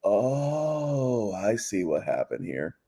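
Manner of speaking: dawning, amused discovery; sly realization. Pretend. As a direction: viewer voicing the twist with a knowing sigh. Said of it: "it's just like".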